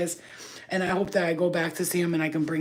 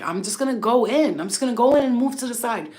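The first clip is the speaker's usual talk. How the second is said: raised, higher, insistent retelling; defiant; 6 semitones higher, 7 dB louder than usual. Retold or imitated. Driver retelling a standoff at the entrance, defiant. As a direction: loudly announce your own decision. Retold defiance in traffic dispute.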